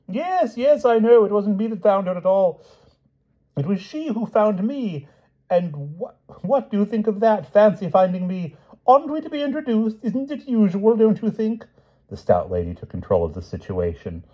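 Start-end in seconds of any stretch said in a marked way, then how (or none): 0.1-8.6 high, effusive babble; excited dotty king. A narrator's reading. King admits she found him, excited. Flustered king answers lady. 8.9-11.7 very high, fussy questioning; excited dotty king. A narrator's reading. King asks to be introduced, excited and high.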